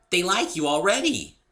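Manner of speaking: high, loud, chirpy voice; bubbly pony. A narrator's reading. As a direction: beam it at your new friend. Pinkie to Dave.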